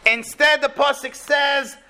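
ordinary delivery

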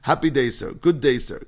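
put-on loud, chanting run of Caribbean-accented greetings; imitated Caribbean-sounding accent. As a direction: keep the cheerful greetings rolling, mimicking a Caribbean accent. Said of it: "when I do this accent".

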